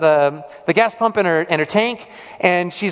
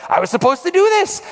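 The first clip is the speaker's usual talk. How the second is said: mild self-frustration, pitched higher; frustrated; 11 semitones higher, faster than usual. Retold or imitated. Preacher recalling leaving without doing it, mildly frustrated. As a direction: replay a touch of exasperation at yourself. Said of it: "I was frustrated with myself".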